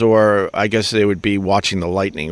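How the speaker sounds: ordinary delivery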